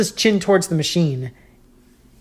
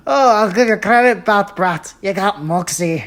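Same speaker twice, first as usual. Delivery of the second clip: amused Joker; 3 semitones higher than usual. Acted. Joker taunts Robin after laughing.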